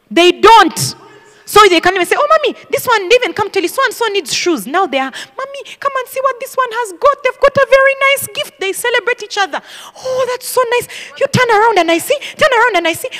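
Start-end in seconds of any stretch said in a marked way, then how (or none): 2.2-4.6 higher-pitched, lightly voiced child; a hint of an eager little tattletale; child to mommy. Voicing her children to mommy. 5.1-8.6 put-on pitched well up, little-girl excitement; child calling mommy. Child showing sister's gift to mommy. 10.0-13.1 high-pitched mock delight; lightly acted, high admiring voice; admiring cooing. Acted admiring of child's new clothes.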